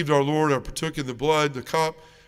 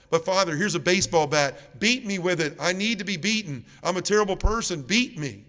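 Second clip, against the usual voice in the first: higher-pitched retold begging; desperate pleading; 4 semitones higher than usual. Penitent begs the priest to beat him, desperate pleading. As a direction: plead for punishment, voice lifted.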